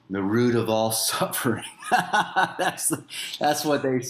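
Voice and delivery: laughing through the words; chuckling while speaking. Real, not acted.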